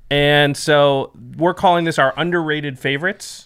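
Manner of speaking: ordinary talk, nothing marked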